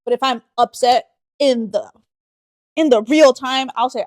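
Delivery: usual speaking voice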